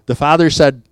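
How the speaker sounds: ordinary talk, nothing marked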